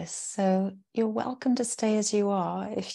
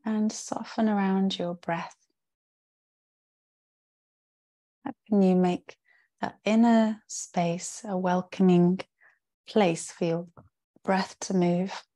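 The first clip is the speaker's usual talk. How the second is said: guided meditation. Real, not acted. Yoga teacher settling the class into rest, calm guided-meditation voice. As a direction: guide them into rest, with spacious pauses between phrases. Leads a yoga nidra practice.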